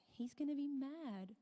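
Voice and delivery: usual speaking voice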